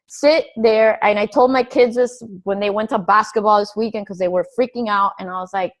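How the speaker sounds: ordinary talk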